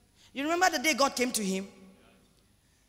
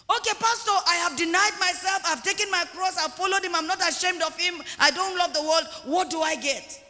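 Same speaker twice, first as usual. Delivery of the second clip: higher-pitched member reeling off good deeds; eager congregant; 4 semitones higher than usual. Acted. Church member asks pastor for a reward, eager. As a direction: plead your case to the pastor.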